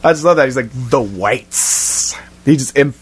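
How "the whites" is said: low, soft "whites" with a long trailing s; emphatic delivery. Retold or imitated. Repeating a loved film delivery, "whites" emphatically drawn out. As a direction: lean on "whites" and draw out its final s. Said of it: "the emphasis".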